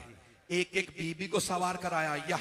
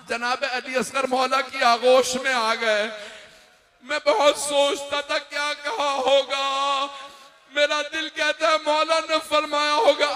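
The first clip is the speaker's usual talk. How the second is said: rising majlis lament; 7 semitones higher, 8 dB louder than usual. Acted. Preacher building grief before the crowd, rising lament. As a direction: lament swelling higher and louder.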